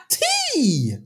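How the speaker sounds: high teasing voice, laughing through it; mock jeering. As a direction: jeer like a mocking crowd. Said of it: "imagined mockers".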